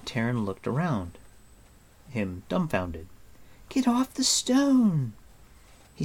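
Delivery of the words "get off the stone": high, slow, urgent cry; high urgent call. A narrator's reading. Unseen girl calls from below.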